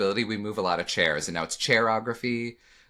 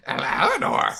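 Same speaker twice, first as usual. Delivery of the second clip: loud, high Cockney squawk; terrible British accent; 10 semitones higher, 5 dB louder, slower than usual. Retold or imitated. Actor mocking own British accent at table read, squawking. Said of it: "My British accent sounds like Gilbert Gottfried".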